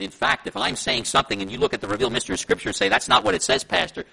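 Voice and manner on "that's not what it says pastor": louder, firm challenge to the pastor; outspoken objection. Acted. Church member challenging the pastor on Scripture, outspoken objection. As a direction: speak up and object plainly. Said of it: "you're to speak up".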